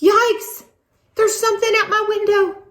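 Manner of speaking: high, fast startled cry; frightened. Acted. Scared child hearing a noise at the window at night. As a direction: gasp it out in a rush, pitched high.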